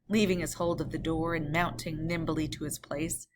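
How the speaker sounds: plain reading voice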